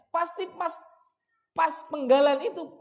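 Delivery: usual speaking voice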